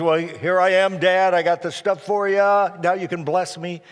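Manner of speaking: eager Esau. Retold or imitated. Son presenting his hunt to his father, eager and loud. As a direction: call out brightly to dad.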